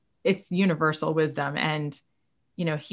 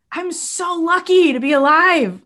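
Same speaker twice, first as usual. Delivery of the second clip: joyful; 10 semitones higher, 7 dB louder than usual. Real, not acted. Exclaiming joy in being alive.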